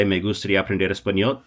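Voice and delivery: "Me gustaría aprender español" run together fast; quick flowing demo. Shown as a demonstration. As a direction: rattle off "Me gustaría aprender español" in one breath. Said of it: "Is it magnetic to say".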